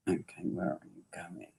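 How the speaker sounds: very soft, quick mutter; murmured aside. Real, not acted. Medium asking himself where it is going, murmured aside. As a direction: mutter it under your breath, barely audible.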